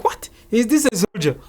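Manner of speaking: high-pitched disbelief; retold surprise. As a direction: ask it in astonished disbelief. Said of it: "I was, like, so surprised".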